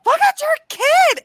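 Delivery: high, loud, drawn-out yell; sister's shout. Retold or imitated. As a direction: shout it out for the whole patio to hear. Sister calls out the peeing child.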